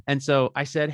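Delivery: usual speaking voice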